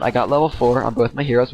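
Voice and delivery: normal talking voice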